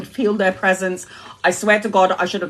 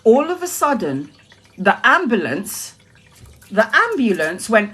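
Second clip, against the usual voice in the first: ordinary talk, nothing marked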